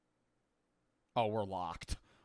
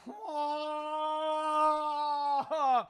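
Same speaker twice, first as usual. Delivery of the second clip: drawn-out high cry of defeat; high, stretched-out howl of dismay; long high wail; 11 semitones higher than usual. Real, not acted. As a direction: wail on one held high note. Long wail after losing a round.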